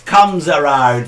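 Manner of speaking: slow, deliberate proverb; mum's saying. Retold or imitated. Quoting his late mum's saying, slow and emphatic. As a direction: spell out the proverb slowly and loudly. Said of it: "as my mum would say".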